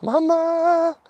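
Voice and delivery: a squeaky high yelp of "Mama!"; dog's high call. Retold or imitated. He mimics his dog calling from home in a high yelp. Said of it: "I just heard my dog go".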